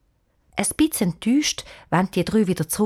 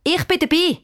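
calling out; 10 semitones higher than usual. A narrator's reading.

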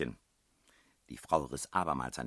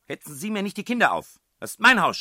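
high furious shriek; sudden loud shout; 17 dB louder, faster than usual. A narrator's reading. Headmistress defends her house, sudden high shouting. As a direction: explode into a territorial shout. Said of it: "schrie sie plötzlich laut".

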